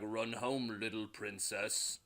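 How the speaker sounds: low, quiet sneering taunt; mocking taunt. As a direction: belittle him under your breath. Giant king taunts Thor.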